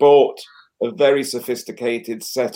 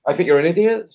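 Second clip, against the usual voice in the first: sweet rising lilt on "I think you're an idiot"; mock Australian uptalk; upward inflection; 5 semitones higher, faster than usual. Shown as a demonstration. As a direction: insult with a polite upward tune. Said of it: "So people like to say".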